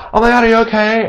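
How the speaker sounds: high, worried passerby voice; alarmed pastor. Acted. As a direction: rush over to a hurt stranger. Voicing pastor finding injured cyclist.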